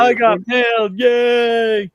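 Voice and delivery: high, shouted puppet-character voice; Crank Yankers impression. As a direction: cheer it in a high, goofy put-on voice. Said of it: "what about Crank Yankers?".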